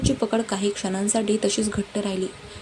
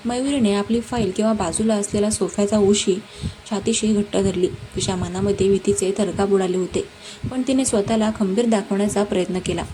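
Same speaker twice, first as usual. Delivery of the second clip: ordinary delivery, nothing marked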